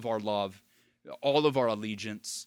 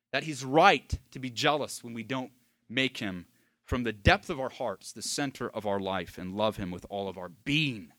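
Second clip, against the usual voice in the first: ordinary talk, nothing marked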